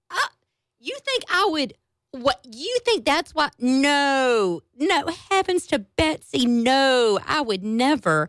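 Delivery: high, hammed-up innocent protest; mock innocent old man. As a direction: play the wide-eyed denial, clearly mocking. Mocking the man's innocent excuse.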